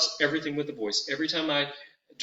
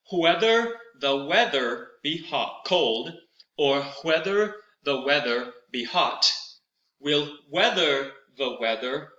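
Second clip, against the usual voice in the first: slow, crisp, with hw on whether and whatever; slow careful articulation; slower than usual. Shown as a demonstration. Teacher reciting slowly without fingers, stressing the hw sound. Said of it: "try it without fingers and observe how easy".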